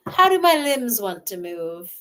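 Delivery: normal talking voice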